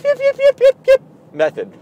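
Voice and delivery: very high, loud yapping yips; brother's yip yip. Shown as a demonstration. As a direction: yip like an excited little dog. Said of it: "Try the yip, yip".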